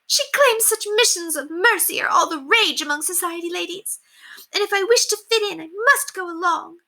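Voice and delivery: high, scornful society lady; appalled mistress reading the card; disgusted haughty lady. Acted. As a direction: rant in disdain. Lady Constance complains.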